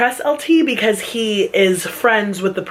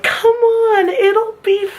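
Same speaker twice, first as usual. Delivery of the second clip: coaxing; 12 semitones higher, much slower than usual. Retold or imitated. Coaxing friend to meet him.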